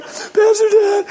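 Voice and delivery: very high, drawn-out tearful wail; imitated sobbing caller; mock crying. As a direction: blubber it into the phone, exaggerated. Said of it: "She's crying".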